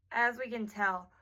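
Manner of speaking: ordinary talk, nothing marked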